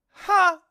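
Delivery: sudden high, loud "ha"; demonstrated own laugh. Retold or imitated. Proving to the actress it was his laugh, loud "ha". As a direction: bark one weird, loud laugh. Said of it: "this way that I laugh".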